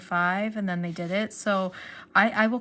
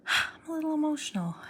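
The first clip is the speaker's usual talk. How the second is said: emotional whisper; 8 semitones higher, faster than usual. Real, not acted. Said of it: "I am tearing up".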